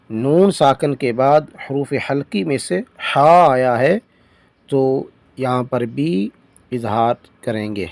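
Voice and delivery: ordinary talk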